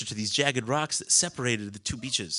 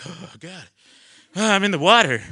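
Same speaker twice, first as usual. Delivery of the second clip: panicked; 6 semitones higher, 10 dB louder than usual. Retold or imitated. Landing in the ocean panicking.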